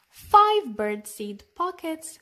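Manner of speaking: plain reading voice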